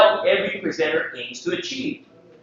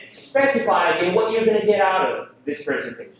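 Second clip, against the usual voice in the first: normal talking voice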